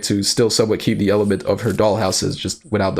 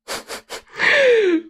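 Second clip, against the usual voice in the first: high-pitched giggle; high laugh. Real, not acted. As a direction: squeal of laughter. Laughing at fanfic.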